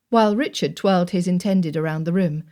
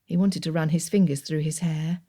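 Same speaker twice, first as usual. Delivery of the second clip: usual speaking voice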